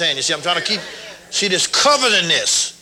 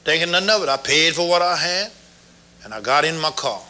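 ordinary talk

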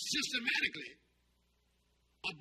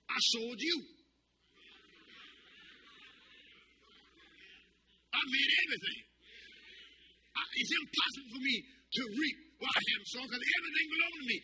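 raised, forceful preacher as God; indignant retort, pitched up; the Lord retorting; 7 semitones higher than usual. Retold or imitated. God setting the foolish servant straight, indignant retort. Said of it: "But the Lord said".

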